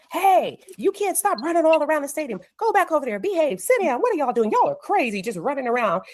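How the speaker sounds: high-pitched scolding, only mock-stern; lighthearted mock yelling. Coach to kids running round the stadium, lighthearted mock yelling. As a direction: playfully call out to unruly kids. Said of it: "this was kinda lighthearted".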